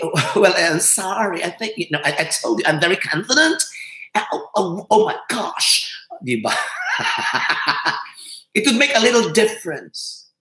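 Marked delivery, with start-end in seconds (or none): none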